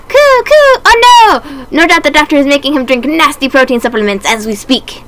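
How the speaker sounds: high, loud coos then a worried birdlike voice; a frantic dove raising the alarm; alarmed pigeon character. Acted. Pigeon Oka-san alarmed that the doctor took Ryota.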